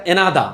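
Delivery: এনাদা with the final R dropped; non-rhotic এনাদা; British silent R. Shown as a demonstration. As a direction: say এনাদা British-style, ending on an open vowel. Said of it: "লাস্টের এ আর কে উচ্চারণ করে না".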